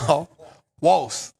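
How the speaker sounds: ordinary talk, nothing marked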